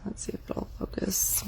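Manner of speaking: breathy whispered aside. Real, not acted. Vlogger checking the camera focus, whispering. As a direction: whisper it to yourself while checking the shot.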